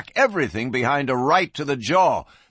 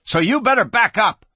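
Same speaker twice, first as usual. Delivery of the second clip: high-pitched, forceful holler; 9 semitones higher, 4 dB louder than usual. A narrator's reading. Panicky gun kid ordering the marshal back, hollering. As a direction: holler at him to retreat. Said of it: "the kid hollered".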